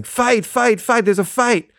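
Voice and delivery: high-pitched imitation of kids yelling fight; kids' fight call, retold in a high voice; excited kids. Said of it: "all these kids start running up and going".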